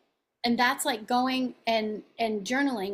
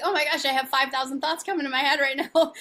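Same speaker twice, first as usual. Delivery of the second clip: fast, laughing rush of words; playfully flustered, racing; excited overwhelm; 3 semitones higher, 4 dB louder, faster than usual. Acted. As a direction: blurt it out quickly with a laugh. Acts out own racing thoughts.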